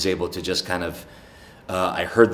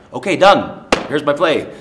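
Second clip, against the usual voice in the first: imitating students; 8 semitones higher, 7 dB louder than usual. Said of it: "they're like".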